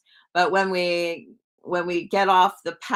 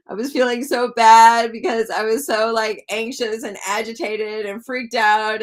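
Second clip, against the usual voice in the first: louder, tearful voice; 4 semitones higher, 6 dB louder than usual. Real, not acted. Telling viewers the feeling, loud and tearful. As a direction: still fighting tears, pushed loud. Still tearful after retelling.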